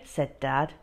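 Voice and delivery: ordinary delivery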